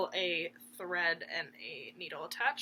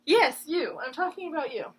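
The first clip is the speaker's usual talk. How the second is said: high, loud mock scold; playful scolding; 6 semitones higher, 7 dB louder than usual. Real, not acted. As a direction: tease the dog, half-stern.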